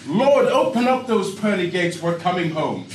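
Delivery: loud, hammed-up cry to heaven; mock shout. Injured man's particulars cry out to the Lord, shouting. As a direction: bellow it like a desperate prayer. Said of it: "my particulars shouted out".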